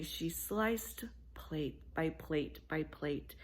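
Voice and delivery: slow careful whisper. A narrator's reading. Narrator whispers the careful slicing, slow.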